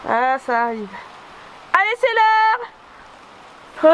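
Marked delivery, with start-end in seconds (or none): 1.7-3.9 slow, high, drawn-out call to the horses; high sing-song call. Real, not acted. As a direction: call them in, high and stretched out. Calling horses to eat.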